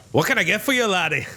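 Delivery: a hint of a gruff Scottish shopkeeper; Scottish accent. Dwarf shopkeeper greets Travis in a light Scottish accent. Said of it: "the classic Scottish accent".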